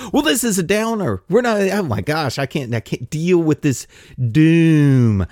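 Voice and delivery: high, whiny mock complaint; whiny listener. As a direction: play a sulky listener groaning. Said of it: "be like".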